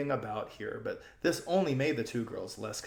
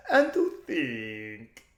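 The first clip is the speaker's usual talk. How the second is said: almost crying; 5 dB louder, much slower than usual. A narrator's reading. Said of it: "almost crying".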